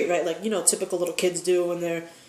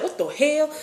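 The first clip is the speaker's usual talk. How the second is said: higher, bewildered "what the hell"; baffled; 7 semitones higher than usual. Retold or imitated. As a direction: react in total confusion. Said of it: "I'll be like".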